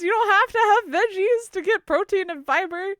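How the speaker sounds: high, loud protesting lecture; exasperated insistence. Real, not acted. Answering a veggie hater about protein, exasperated insistence. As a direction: argue it loudly, voice way up.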